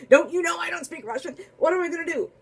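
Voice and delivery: high, panicky plea to God, hammed up; mock panic. Priest panics as the Russian woman comes closer, high and frantic. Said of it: "and he's like".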